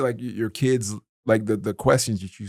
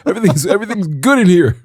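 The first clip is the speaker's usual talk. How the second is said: much higher and louder, laughing; mock calm through laughter; 9 semitones higher, 8 dB louder than usual. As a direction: joke that all is fine while laughing. Joking everything is fine.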